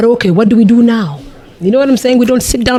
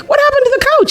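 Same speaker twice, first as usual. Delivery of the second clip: shocked question; faster than usual. Retold or imitated. Finding couch sliced.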